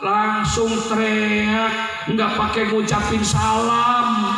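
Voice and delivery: loud, high sermon storytelling; full-force preaching. Acted. Preacher raises voice telling the story.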